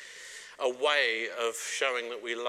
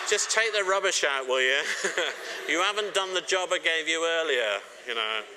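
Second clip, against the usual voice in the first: louder playful nagging, a bit higher; mock bossy wife; 5 semitones higher, 5 dB louder than usual. Wife teasingly ordering husband to take rubbish out, loud mock nag. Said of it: "She says".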